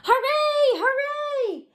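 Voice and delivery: squeaky joyful cheer. A narrator's reading. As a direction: cheer like happy toys. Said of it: "cried little clown".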